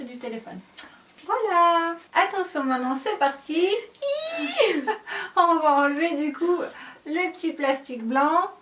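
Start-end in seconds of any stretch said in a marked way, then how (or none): 3.7-5.3 high giggle. Real, not acted. Starting the unboxing.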